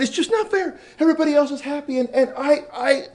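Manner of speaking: very high, loud imitation of an upset boy; all worked up. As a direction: protest in a stammering, worked-up mock wail. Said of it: "he got all worked up and all upset".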